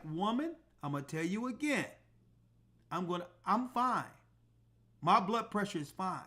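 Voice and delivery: quiet, deliberate putdown; low-key firm warning, slowing at the end; firm and calm. Retold or imitated. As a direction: lay down the law softly. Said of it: "And I told her just like that".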